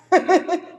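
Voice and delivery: short loud laugh. Real, not acted. Speaker laughing after her own impression.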